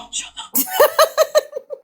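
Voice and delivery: real burst of very high laughter; high cracking-up laugh. Real, not acted. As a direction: crack up mid-sentence, high and long. Laughing at their mistaken call.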